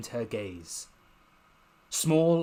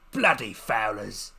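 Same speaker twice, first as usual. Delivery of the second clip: slow, lower gruff growl of a curse; gruff werewolf curse; 3 semitones lower, 4 dB louder than usual. Acted. Werewolf cursing the fowlers while shaking his fist. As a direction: growl it like a big wolf.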